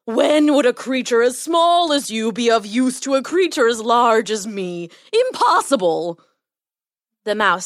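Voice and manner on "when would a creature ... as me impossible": incredulous lion, stretching the words; amused, scornful lion. A narrator's reading. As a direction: scoff at a tiny creature as the big lion. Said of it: "roared with laughter and said".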